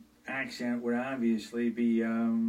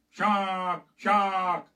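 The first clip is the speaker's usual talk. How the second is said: shouted warning, higher and louder; yelled warning; 7 semitones higher, 7 dB louder than usual. Acted. Actor bellowing a warning to swimmers, loud and high. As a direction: shout it to the whole pool. Yelling shark in the announcement.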